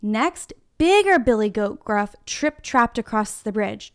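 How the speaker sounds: normal talking voice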